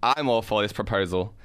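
ordinary talk, nothing marked

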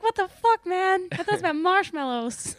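high, outraged candy mascot; indignant Candyman. Pretend. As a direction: squawk it like an offended mascot. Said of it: "the Candyman is like".

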